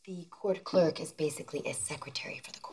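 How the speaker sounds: low, hushed explaining; explanatory aside. Acted. Daughter explaining legal terms to her mother, hushed aside.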